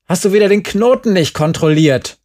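high, loud accusation; angry shout. A narrator's reading. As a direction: yell an accusing question. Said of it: "schrie Gerd". (vorwurfsvoll schreien)

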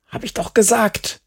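high, tense hiss; irritated boy hissing a reply; angry hiss. A narrator's reading. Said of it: "zischte Markus".

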